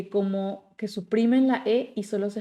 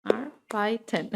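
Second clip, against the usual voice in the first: arbeiten stretched out slowly, softer; slow syllable by syllable; 2 semitones higher, 3 dB quieter, much slower than usual. Shown as a demonstration. Teacher slows arbeiten down so students hear the syllables. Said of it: "separar en sílabas".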